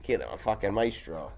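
normal talking voice

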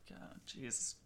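disgusted whisper. Real, not acted. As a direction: breathe it out in disgust.

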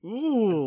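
high, drawn-out "ooh"; long high mock wince of sound; mock "ooh" reaction. Reacting to a choppy cutscene, high drawn-out "ooh".

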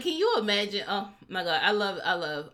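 ordinary talk

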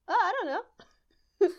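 high, loud, drawn-out laughing wail; real laughter pushing the words up high and slow; helpless laughing. Real, not acted. Guest put on the spot for a Best Picture pick, helpless laughing.

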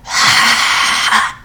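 long unvoiced ghostly exhale; eerie breathy moan. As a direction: breathe out a spooky groan. Eerie noise heard in night.

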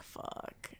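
hushed, breathy whispered "Fuck"; whispered curse. Retold or imitated. Comic realising she went too far, a whispered curse. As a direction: breathe it out under your breath. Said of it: "And I was like".

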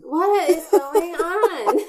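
slow, high, laughing disbelief. Real, not acted. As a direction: laugh through the question. Laughing at the glitch.

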